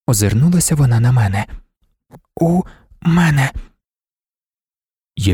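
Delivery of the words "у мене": high and slow, a gap between words; unmodulated, choppy speech. A narrator's reading. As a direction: deaf woman's halting, word-by-word reply. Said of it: "Вона вимовляла немодульовано й уривчасто".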